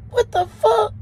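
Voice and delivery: high, loud, rising shock; shocked disbelief. Retold or imitated. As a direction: blurt it out, voice jumping way up. Shocked by talking roach.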